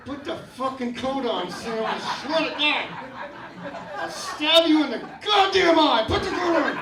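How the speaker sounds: rising to a loud furious threat; gritted-teeth rage boiling into a yell; through gritted teeth. Retold or imitated. Dad at his son in the rain, snapping then yelling. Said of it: "You start talking through your teeth".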